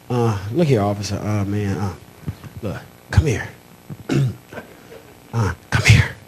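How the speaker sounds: low, secretive, hesitant; hushed confession. Retold or imitated. Confiding secret to the cop.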